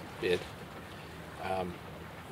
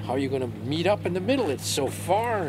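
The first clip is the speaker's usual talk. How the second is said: high, loud disbelieving question; mock astonishment at a crazy plan; amazed engineer; 9 semitones higher, 5 dB louder than usual. Engineer stunned by the tunnel plan, high incredulous voice.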